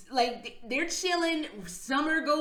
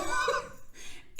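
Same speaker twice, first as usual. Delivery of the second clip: breathless laugh; 12 semitones higher, 4 dB quieter than usual. Real, not acted. Laughing at the gnome story.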